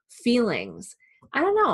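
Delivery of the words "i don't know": sudden high, louder I don't know; unsure high exclaim. Retold or imitated. Acting out a client's unsure reply.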